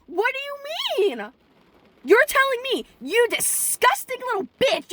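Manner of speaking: furious yelling rant. Acted. Finally telling off the snotty classmate, furious high-pitched yelling. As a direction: let it all out at the kid in a shrill yell. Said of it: "I'm gonna say".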